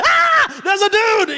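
sudden high yelp of surprise; startled shout. Retold or imitated. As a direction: shout it out like you just spotted a man dropping from the ceiling. Congregation spots man descending.

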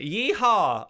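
loud, high southern cowboy whoop; a southern Yeehaw hollered loud. Acted. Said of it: "I had to find it again".

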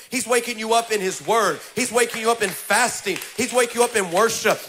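loud, rhythmic preaching cadence; preaching at full force. Acted. Pastor rousing the congregation to wake up, preaching loudly. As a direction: drive each line home to the crowd.

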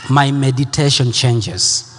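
low, slow, prayerful repetition; hushed worship leader, unhurried. Acted.

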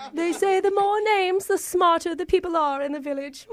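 hammed-up British villager; mock-quaint British; fake British accent. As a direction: put on a knowing British accent.

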